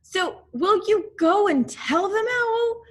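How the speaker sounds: put-on high, loud pleading boy; boy begging Owl to go; eager boy. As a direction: urge Owl on brightly. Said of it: "Christopher Robin asks Owl".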